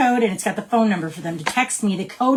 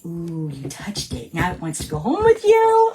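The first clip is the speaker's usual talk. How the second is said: playful teasing; 3 semitones lower than usual. Acted. Acts out teasing a customer.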